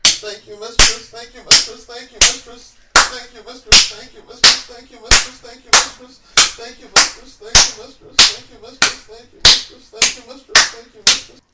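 loud, high, near-tearful chant. Acted. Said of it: "His voice grew louder with each repetition".

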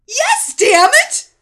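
screaming. Acted. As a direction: scream it back defiantly. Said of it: "she screamed".

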